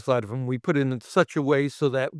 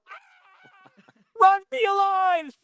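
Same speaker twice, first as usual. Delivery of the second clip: much higher, slow mock panicked cry; much slower than usual. Crew fleeing the burning money, high mock panic. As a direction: ham up the crowd fleeing.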